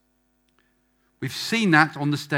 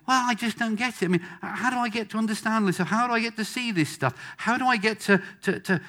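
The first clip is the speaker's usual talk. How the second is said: higher-pitched imitation of baffled people; puzzled questioner; 9 semitones higher than usual. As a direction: mimic someone pleading to understand.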